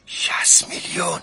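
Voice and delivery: forceful, slow stage whisper; hushed triumph. Acted. Husband revealing his profit to wife, in a triumphant whisper. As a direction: whisper the big figure with weight.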